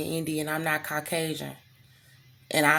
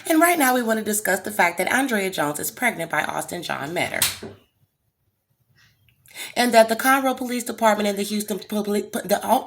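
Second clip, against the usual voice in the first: mock proper American voice; 4 semitones higher than usual. Shown as a demonstration. Exposing the case to viewers in a mock proper voice. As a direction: keep up the proper act.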